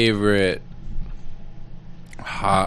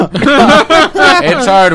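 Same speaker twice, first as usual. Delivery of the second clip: laughing; 12 semitones higher, 7 dB louder than usual. Real, not acted. Laughing about peanut butter.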